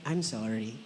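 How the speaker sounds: low, soft sincere sorry; soft apology. Shown as a demonstration. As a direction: say it gently to your neighbour. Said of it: "Say to your right". (mahinahong sorry sa katabi)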